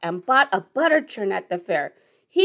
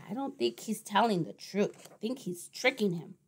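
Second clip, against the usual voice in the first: soft, much quieter conspiratorial aside; hushed aside; 3 semitones lower, 12 dB quieter than usual. Real, not acted. Reader whispering a suspicion to the child, very soft. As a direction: lean in and share the secret.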